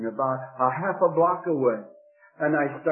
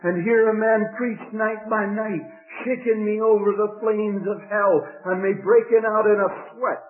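ordinary talk

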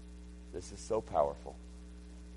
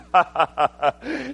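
put-on scoffing laughter; mocking laugh; 10 semitones higher, 11 dB louder than usual. Sons-in-law laughing at Lot's warning, acted mocking laugh. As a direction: laugh him off in a staccato ha-ha-ha.

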